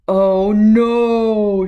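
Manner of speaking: loud shrieked oh no. A narrator's reading. Cat sees the witch turned frog, loud shriek. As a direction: shriek it in horror. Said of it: "shrieked the cat".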